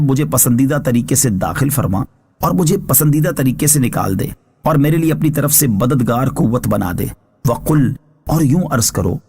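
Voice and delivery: ordinary talk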